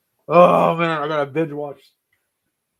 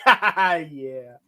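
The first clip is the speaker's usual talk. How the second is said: imitated awkward laugh-off; slower than usual. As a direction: imitate someone laughing off an insult. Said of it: "And he's just like".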